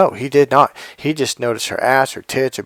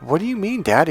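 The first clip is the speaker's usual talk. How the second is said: imitated baffled protest; 6 semitones higher than usual. Son of twenty questioning dad, imitated bafflement. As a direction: play a puzzled young son.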